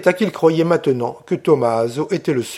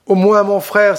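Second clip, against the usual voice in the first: higher, louder appeal; pleading cry; 6 semitones higher, 5 dB louder than usual. A narrator's reading. Colomba begging her brother, hands joined, pleading cry. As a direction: cry out as a plea. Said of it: "s'écria Colomba les mains jointes".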